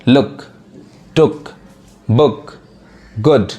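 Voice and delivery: लुक, टुक, बुक, गुड each said with a quick short "u"; short sound. Shown as a demonstration. Teacher drilling fast reading, short "u" in लुक, टुक, बुक, गुड. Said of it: "लेकिन fast पढ़ाएगा".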